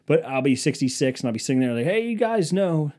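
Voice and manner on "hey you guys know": ordinary talk, nothing marked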